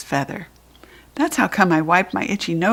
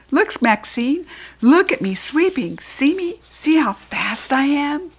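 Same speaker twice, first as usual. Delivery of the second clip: higher eager call; showing off; 8 semitones higher than usual. A narrator's reading. Judy B showing off sweeping to Maxine. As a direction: look at me, proudly.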